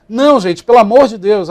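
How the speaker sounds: ordinary talk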